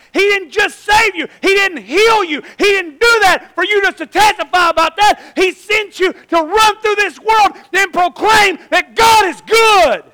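high, slow, shouted exhortation; preaching at full force. Real, not acted. Preacher exhorting the congregation sitting here, full-force preaching. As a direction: thunder at the congregation, stretching key words.

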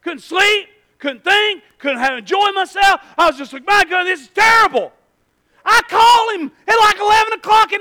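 loud anguished preaching yell; shouting. Retold or imitated. Preacher shouting how gas tormented him. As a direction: cry it out to the congregation.

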